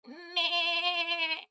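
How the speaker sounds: long, high goat bleat. Shown as a demonstration. Streamer performs goat impression, high bleat. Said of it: "I need to do my goat impression now".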